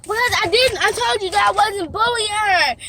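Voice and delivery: high and loud, hurt and furious; upset yelling. Real, not acted. Upset daughter yelling at parent who doesn't understand her. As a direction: lash out at the parent. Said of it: "You can cry and yell if you want".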